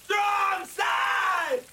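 high, full-voiced war cry; yelling. Runner rallying the group up the mountain, yelling. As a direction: belt it out like a team chant. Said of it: "what are you yelling".

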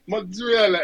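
mock Italian accent. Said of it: "I was mocking their accent".

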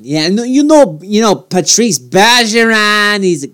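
hammed-up Boston guy praising Bergeron; playful put-on Boston accent. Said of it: "you gotta give a Bergeron better speech".

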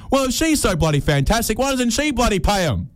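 high, mock-outraged question; indignant rhetorical jab; exasperated. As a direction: throw it up high in disbelief. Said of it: "I thought to myself".

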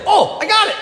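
put-on high, excited kid voice; imitating an excited little kid; eager child. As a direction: burst out like a kid who's got it. Excited child volunteers an answer.

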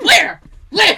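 high, loud eager nephew; nephew excited. Retold or imitated. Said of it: "he's like".

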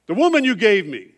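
loud, high, accusing cry of blame; Adam pointing at the woman, loud and high; loud blaming Adam. Retold or imitated. Adam blames Eve before God, a loud accusing cry. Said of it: "what does Adam do".

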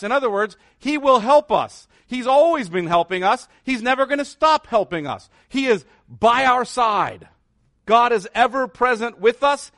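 preaching. Acted. Preacher assuring the congregation God never leaves, fervent. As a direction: preach it out to the room with growing fervor.

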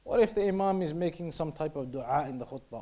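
normal talking voice

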